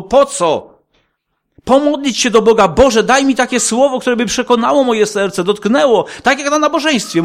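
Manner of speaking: high, loud preacher's emphasis; fiery preaching. Acted. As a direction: preach it hard, lingering on niepotrzebne. Preaching at full force.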